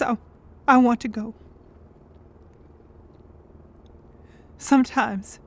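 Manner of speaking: low, halting confession with long pauses; despairing confession. Acted. Answering whether they want to die, despairing confession. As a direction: confess it heavily, low and drained.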